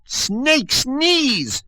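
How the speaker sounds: high, loud raspy witch curse; rasping witch. Acted. Old Witch trapped in the tower, raspy high witch curse. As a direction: spit it out like a cornered old witch. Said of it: "she rasped".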